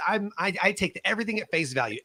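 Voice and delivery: normal talking voice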